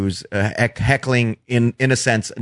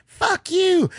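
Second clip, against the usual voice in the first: mock drunk woman. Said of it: "Why do you keep flipping me off".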